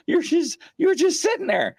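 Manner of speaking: high-pitched stammering disbelief; high, flabbergasted mimicry; retold amazement. Retelling friend's amazed reaction.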